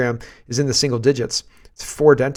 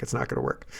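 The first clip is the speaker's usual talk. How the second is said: unvoiced knowing aside; whispered it's not gonna work; 10 dB quieter, faster than usual. Real, not acted. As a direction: drop to a whisper.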